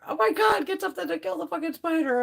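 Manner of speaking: high, mock panicked yell for help; imitated yelling. Friend yelling to her daughter about the spider, a high mock yell. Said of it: "she's yelling for her daughter".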